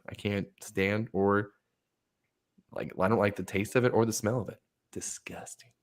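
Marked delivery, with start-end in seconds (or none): 4.9-5.7 near-whisper, drained of voice; hushed disgust. Real, not acted. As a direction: whisper it in revulsion. Emphatic disgust at coffee.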